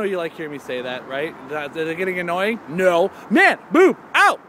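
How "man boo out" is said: shouted catchphrase. Acted. Catchphrase repeated.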